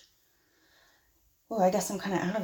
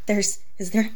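ordinary talk, nothing marked

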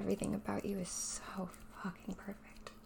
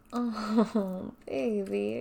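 usual speaking voice

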